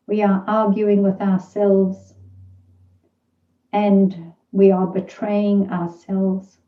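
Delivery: measured meditation voice with long, spacious pauses; guided meditation. Real, not acted. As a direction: let each phrase settle before the next.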